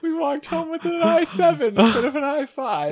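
laughing. Real, not acted. Laughing over a funny story.

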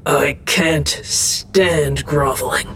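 low, slow, grumbling deity; grousing god. A narrator's reading. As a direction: grouse it slowly in a deep godly voice. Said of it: "the god groused".

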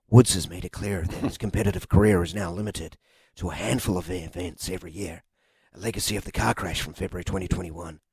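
hushed, reverent golf commentary; golf commentator. Shown as a demonstration. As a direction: murmur it like at the Masters. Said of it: "you've got to drop your voice".

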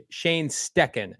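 "Stecken" with a hard k, drawn out slowly; corrected pronunciation. Shown as a demonstration. Host tries out the corrected surname, "Stecken" slow with k. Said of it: "I'll take your word for it".

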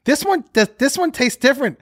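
put-on high-pitched puzzled little kid; daughter's voice. Daughter eating the dog treat, high child voice. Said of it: "she goes".

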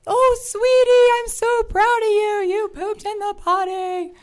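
high, sweet, doting mom praise; nurturing mommy voice. Retold or imitated. Mimicking the friend praising her daughter in a high nurturing mommy voice. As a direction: coo it like a proud mother. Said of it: "the very nurturing mommy thing".

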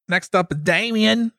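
put-on a weak, half-hearted Australian accent; slow, hammed-up Australian announcement, barely there; lightly put-on Australian accent, slow; bad Australian accent. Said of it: "the worst Australian I've done in a while".

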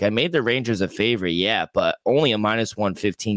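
usual speaking voice

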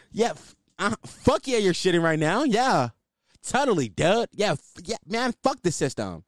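lightly voiced stoked bro; mild snarling punk dude.

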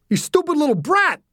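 high-pitched enraged yell, narrated; angry scream. A narrator's reading. Driver to the girl who nearly hit him, screaming. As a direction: scream abuse at a reckless kid. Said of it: "the man screamed".

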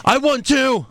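high-pitched mock claim of victory; mimicked wrestler's boast. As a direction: insist on it in a put-on high voice. Mimics Owens claiming the win.

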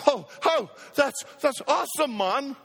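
badly faked Brazilian accent, pitched high; playful Brazilian accent slipping into Jamaican; Pastor Lucas. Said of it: "I got the accent wrong".

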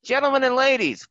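high, loud showman welcome; announcer voice. Acted. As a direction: greet the crowd like a ring announcer. Show host opens broadcast.

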